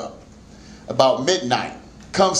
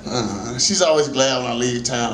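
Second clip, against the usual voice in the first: ordinary delivery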